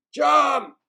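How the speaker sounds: loud, high pirate yell. A narrator's reading. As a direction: pirates ordering the troll off. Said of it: "yelled the pirates".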